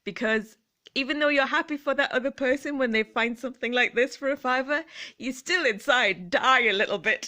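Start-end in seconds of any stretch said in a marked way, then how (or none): 5.2-7.3 laughing. Real, not acted. Laughs while confessing envy.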